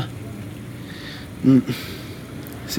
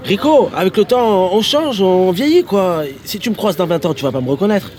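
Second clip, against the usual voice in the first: exasperated reasoning; 9 semitones higher, 5 dB louder than usual. Acted. Friend tells Rico that people age, exasperated insistence. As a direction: press the point, pitched well up.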